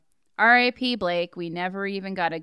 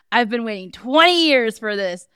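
higher, louder demon taunt; possessed villain voice; 6 semitones higher, 6 dB louder than usual. Acted. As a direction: gloat like a possessed killer. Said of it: "Jennifer slash Alan says".

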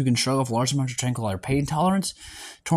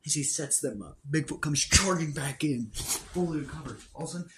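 story narration; 6 dB quieter than usual. Acted. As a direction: tell the fight like a story. Narrates the battle scene.

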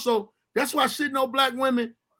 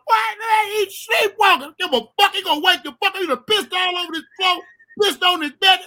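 higher, louder scolding mother; ranting mama; 9 semitones higher, 4 dB louder than usual. Retold or imitated. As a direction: scold like a fed-up mom. Mama ranting about pissed floor.

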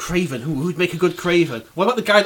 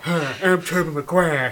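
mock Tobey intro; slower than usual. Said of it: "It's like".

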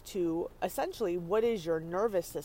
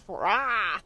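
mock T-Rex roar; 5 dB louder than usual. Mimicking T-Rex.

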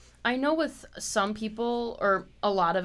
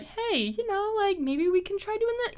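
gentle suggestion; 12 semitones higher than usual. Retold or imitated. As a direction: coax a friend gently. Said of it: "I'll be like".

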